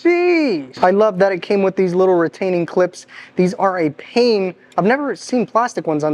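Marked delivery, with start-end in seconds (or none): 0.1-0.8 surprised. Real, not acted. Reacting to the chrome.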